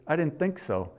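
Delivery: ordinary talk, nothing marked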